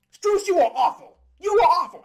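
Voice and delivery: loud, blunt condemnation; angry jab. Real, not acted. Fan berating Strus for his game, loud and blunt. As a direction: hammer it at the player.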